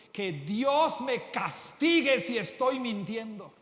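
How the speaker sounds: slow, loud desperate oath; acted oath. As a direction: swear it slowly with full force. Peter swears an oath.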